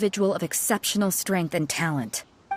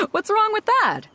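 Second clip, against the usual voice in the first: high laugh then a playful question; laughing innocently; 14 semitones higher than usual. Acted. Host laughing off the accusation, playfully innocent.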